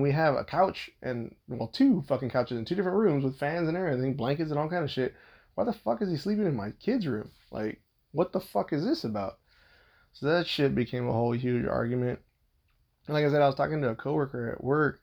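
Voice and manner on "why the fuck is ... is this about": exasperated questions, starting high; indignant. Real, not acted. Husband venting about who sleeps in his kid's room, indignant. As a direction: demand an answer, voice pitched up then easing.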